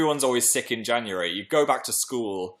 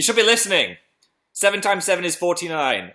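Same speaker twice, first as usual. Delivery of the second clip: lightly voiced teacher, louder and higher; mock teacher; 6 semitones higher, 5 dB louder than usual. To pupils watching in class, a hint of stern teacher. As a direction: lecture like a stern schoolteacher, half in jest. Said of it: "I'll just start educating you guys".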